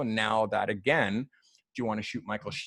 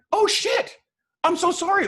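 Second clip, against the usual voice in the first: squeaked way up and louder; mock startled apology; 7 dB louder than usual. Colin Firth apologizing to the photographer, high startled squeak. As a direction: caught out and sheepish.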